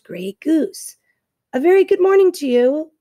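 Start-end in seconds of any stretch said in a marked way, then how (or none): 1.5-2.9 higher, louder robot greeting with evenly held pitch; friendly booming robot. A narrator's reading. Robot greets old goose across the water, friendly and booming. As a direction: boom out a friendly robot hello. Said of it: "the robot's friendly voice boomed over the water".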